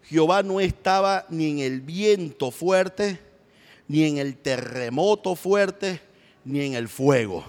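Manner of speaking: drawn out, each word weighed; slow it right down; slow emphasis. Acted. Preacher stressing to church, slow and deliberate.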